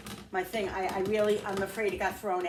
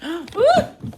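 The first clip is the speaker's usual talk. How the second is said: sharp high gasp and ooh; startled gasp; 12 semitones higher, 17 dB louder than usual. Real, not acted. Cook nearly spills a dish, gasping in alarm. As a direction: caught something just before it fell. Near kitchen mishap.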